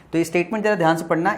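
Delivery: ordinary delivery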